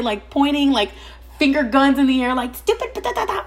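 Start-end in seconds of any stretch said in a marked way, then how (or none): 2.5-3.4 high mock rant of filler syllables; imitated screaming. Wife points finger guns and yells at them, high mock rant. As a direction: spit out stupid then gibberish, much higher. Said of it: "all this shit".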